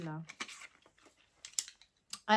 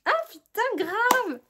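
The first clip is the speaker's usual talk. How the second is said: loud, high burst of excitement; excited outburst; 13 semitones higher, 10 dB louder than usual. Real, not acted. To viewers, thrilled about looking like Hermione, loud and high. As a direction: blurt it out thrilled.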